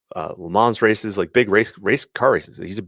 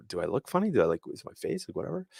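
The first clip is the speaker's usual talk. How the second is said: mock self-doubt; 12 dB quieter, faster than usual. Listener doubting self after questions.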